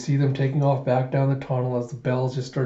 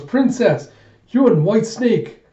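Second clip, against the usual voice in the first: high, loud, drawn-out acted call; dramatised shout, pitched way up; desperate calling out; 8 semitones higher, 7 dB louder, slower than usual. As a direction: call through fallen rock to trapped friends. Calling to friends trapped behind rocks.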